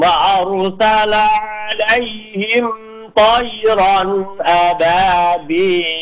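slow melodic tartil chant; teacher modelling tajwid recitation; Quran recitation. Shown as a demonstration. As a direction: recite it measured and sung.

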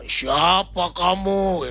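hammed-up demon challenge, very slow; possessed maid. Possessed maid challenges the pastor, slow mock-demonic voice. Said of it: "dia tanya".

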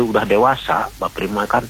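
ordinary delivery

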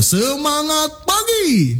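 high, slow, rising greeting; rising opening tone. Shown as a demonstration. Trainer showing rising tone for an opening, high slow greeting. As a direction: open big, lift the pitch. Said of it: "Nada naik digunakan untuk opening".

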